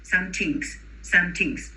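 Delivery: put-on some tinks with a hard T for the th, Spanish accent. Imitating a Spanish-speaking student's th. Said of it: "you heard Barbara say something".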